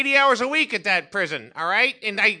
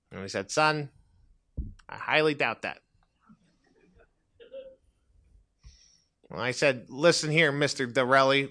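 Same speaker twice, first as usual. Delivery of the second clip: low deadpan reader; 12 semitones lower, 9 dB quieter than usual. Acted. Man reads his memoir piece.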